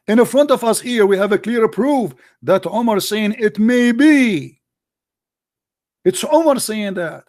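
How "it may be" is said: "may be" drawn out slowly; slow mocking emphasis. Real, not acted. Mocking the quoted phrase.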